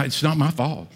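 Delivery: usual speaking voice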